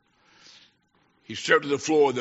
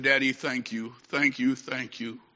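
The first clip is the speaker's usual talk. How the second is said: soft, gentle retold gratitude; tender thanks; 3 semitones lower, 7 dB quieter than usual. Sick daughter thanking Daddy for her medicine, soft and tender. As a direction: say each thank you softly, slowing at the end.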